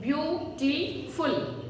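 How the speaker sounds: "beautiful" drawn out slowly as beau-ti-ful, every syllable clear; slow drilled word. Shown as a demonstration. Teacher drilling the class, saying "beautiful" slowly. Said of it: "What it is?".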